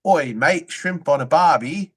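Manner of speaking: slow, hammed-up fake Australian drifting British; a tourist's bad imitation of an Australian accent; mock Australian accent. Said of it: "They go like".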